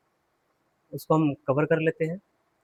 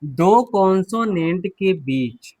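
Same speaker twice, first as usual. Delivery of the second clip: धीरे-धीरे नियम बोलना; slow dictation; much slower than usual. Shown as a demonstration. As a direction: read out slowly like a rule. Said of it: "rule number one कहता है".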